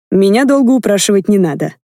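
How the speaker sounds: plain reading voice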